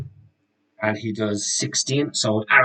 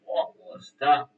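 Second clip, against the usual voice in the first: startled monster voice; 7 semitones higher, much slower than usual. Acted. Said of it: "so you go".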